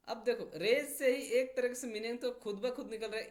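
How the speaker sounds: ordinary delivery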